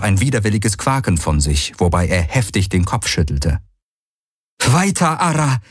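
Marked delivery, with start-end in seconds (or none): 4.6-5.6 high, shaky command; trembling voice. Acted. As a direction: order it with a trembling voice. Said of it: "mit bebender Stimme".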